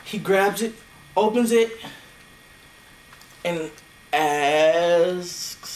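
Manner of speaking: ordinary delivery, nothing marked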